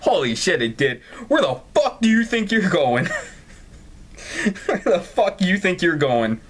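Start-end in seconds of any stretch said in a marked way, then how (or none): 1.3-3.0 mock-tough challenge; mock aggressive. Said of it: "[name], you're aggressive". 3.0-6.4 reading it again through giggles; can't keep it straight, laugh through it; laughing repeat of the line. Real, not acted.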